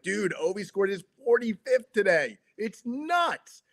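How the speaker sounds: high, excited sports talk among friends; animated inflection. Shown as a demonstration. Sports fan telling his buddies about Ovi's goal, excited. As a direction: blurt it out amazed, pitch soaring. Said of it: "There should be inflection in your voice".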